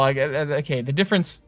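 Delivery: ordinary delivery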